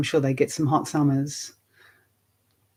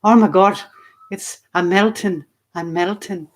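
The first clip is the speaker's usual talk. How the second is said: exclaiming in an imitated Irish accent, higher and louder at first; 4 semitones higher, 5 dB louder than usual. Meditation teacher imitating the Irish in a heatwave, Irish accent. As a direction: play overheated Irish locals, mock dismay. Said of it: "and the Irish were going".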